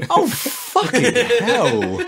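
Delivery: high, drawn-out exasperation; laughing, long-stretched groan of disbelief; exasperated. Real, not acted. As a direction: wail it slowly, high and exasperated. Exasperated at friend's rant.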